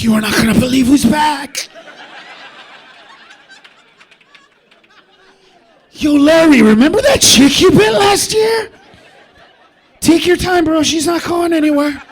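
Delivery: loud, high shouted shark banter; gleeful shark. Acted. One shark calling to Larry, gleeful shouting. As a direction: holler to a buddy across the water. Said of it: "even the sharks were like".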